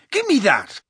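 high-pitched rogue demanding it back; Mundungus. A narrator's reading. Narrator voices Mundungus demanding it back.

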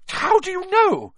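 very high, loud shock; spluttering. A narrator's reading. McGonagall hears a pupil name the Stone, spluttering shock. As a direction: splutter it out, utterly thrown. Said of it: "she spluttered".

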